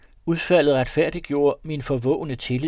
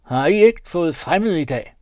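louder, higher demand; calling out; 5 semitones higher, 9 dB louder than usual. A narrator's reading. Robber woman to the people inside, demanding. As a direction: press them through the window.

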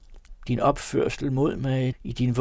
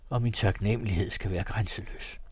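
ordinary delivery

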